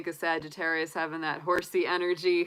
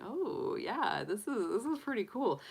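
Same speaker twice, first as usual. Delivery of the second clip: higher, lightly delighted "oh, pretty cool"; pleased discovery; 5 semitones higher than usual. Pretend. Advising Capricorn how to greet life's mysteries, pleasantly surprised. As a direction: let a happy surprise lift the voice. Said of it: "be like".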